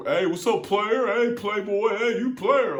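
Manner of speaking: high, loud mock bro greeting, hammed-up; mocking corny greeting. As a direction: ham up a corny dude calling out player. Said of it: "dudes say".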